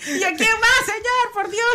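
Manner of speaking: high, exasperated "por Dios" to the Lord; exasperated complaint. Retold or imitated. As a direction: whine it up high as if fed up. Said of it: "le hubiera dicho yo". (queja exasperada y aguda)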